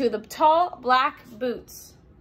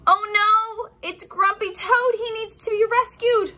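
alarmed; 8 semitones higher than usual. Acted. Toad in danger.

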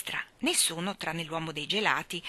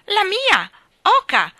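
put-on very high, loud child's yell; 14 semitones higher, 13 dB louder, slower than usual. A narrator's reading. Small boy snatching the goose from his sister, yelling. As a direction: shriek it possessively. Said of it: "urlò Giovannino".

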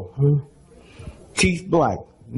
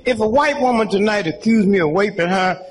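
usual speaking voice

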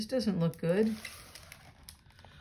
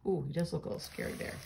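ordinary delivery

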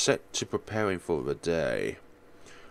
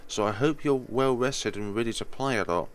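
usual speaking voice

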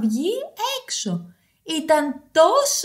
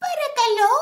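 high-pitched storybook hedgehog; little hedgehog character; 8 semitones higher, 3 dB louder than usual. Acted. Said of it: "είπε ο Σκατζόχυρος". (ψιλή φωνή σκαντζόχοιρου)

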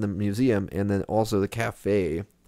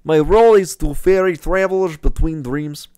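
put-on louder, higher Gru-ish Russian accent; 6 semitones higher, 6 dB louder than usual. Gamer reading the guide in a louder Gru-like Russian accent. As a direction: push the accent toward Gru. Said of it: "sounds a lot more like Gru".

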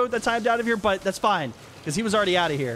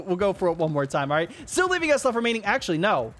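ordinary talk, nothing marked

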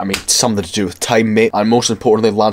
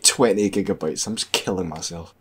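normal talking voice

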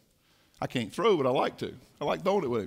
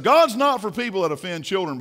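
ordinary delivery

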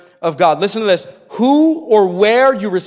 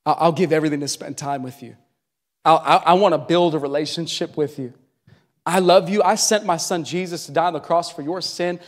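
low, soft and gentle; almost a hush, patient and loving; God answering the distracted, softly and lovingly; God's tender reply; 4 semitones lower, 6 dB quieter than usual. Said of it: "And God's like".

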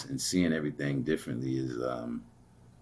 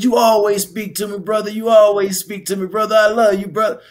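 much higher, louder voice, brother rising; heartfelt man; 12 dB louder than usual. Retold or imitated. The man telling him he loves him, high loud. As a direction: voice him pouring out affection. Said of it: "He said".

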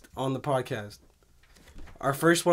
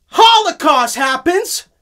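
high, loud, slow proclamation; dramatic announcement; 11 semitones higher, 10 dB louder, slower than usual. Acted. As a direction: announce it like grim news.

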